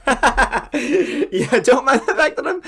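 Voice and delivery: laughing while talking. Real, not acted. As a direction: speak through laughter. GM laughs at own portal.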